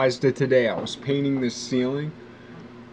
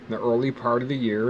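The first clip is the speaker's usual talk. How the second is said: usual speaking voice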